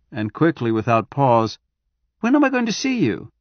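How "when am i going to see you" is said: much higher, urgent question; sudden eager wife. Acted. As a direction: switch abruptly to eager urgency. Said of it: "The change in her voice caught him by surprise".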